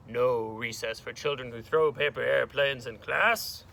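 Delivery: stern teacher laying down a punishment; monstrous teacher's scolding announcement; monster teacher. Acted. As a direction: scold the class with heavy emphasis. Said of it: "Miz Kirby was a monster".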